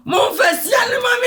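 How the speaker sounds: high-pitched shouted demand; insistent shout. Acted. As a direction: shout it over the other person, voice jumping up. Heated quarrel, repeated demand.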